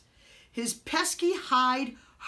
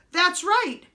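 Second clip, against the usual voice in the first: high, loud eager chime; chiming in; 8 semitones higher, 7 dB louder than usual. A narrator's reading. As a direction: jump in eagerly. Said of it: "Prince Brat chimed in".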